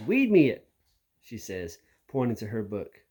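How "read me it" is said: put-on a small kid's voice, higher and louder; lightly voiced little frog-voiced kid; froggy little kid. A narrator's reading. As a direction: play a tiny kid with a frog voice. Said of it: "her voice sounds like a frog".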